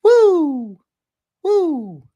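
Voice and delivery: high, loud impression whoop; hammed-up high-pitched woo; Finch woo. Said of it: "Do a Finch impression".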